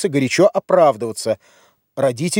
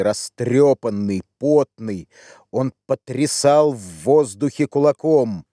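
plain reading voice

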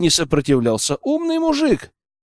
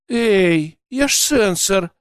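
higher, hurt protest; offended; 5 semitones higher, slower than usual. A narrator's reading. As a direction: sound insulted. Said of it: "с обидой в голосе".

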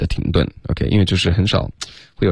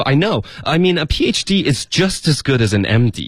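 indignant retort, pitched higher; higher-pitched, mildly aggrieved comeback; griping Ross; 6 semitones higher than usual. Acted. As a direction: push back as if defending your own degree. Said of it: "Ross觉得可以吐槽了".

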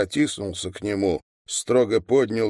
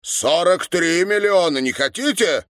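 high, loud challenge; worked-up, scornful correction; emphatic retort; 8 semitones higher, 4 dB louder than usual. A narrator's reading. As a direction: throw the figure in their faces. Old man tops their guess.

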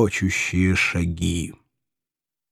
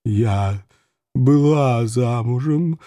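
sad sigh; slower than usual. A narrator's reading. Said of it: "печально вздохнула госпожа Ирида".

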